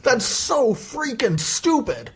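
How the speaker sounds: very high, slow wail of outrage; exasperated rant. Real, not acted. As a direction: stretch every word in high-pitched indignation. Ranting about studio's stupid choice.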